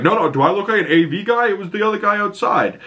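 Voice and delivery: high, defensive put-on voice, lightly mocking; a scoffing mock jock brushing off blame; defensive Guy Gardner. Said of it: "And he's like".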